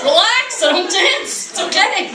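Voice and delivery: high-pitched appeasing voice; placating non-dancer. Acted. Non-dancer offering something else, placating voice.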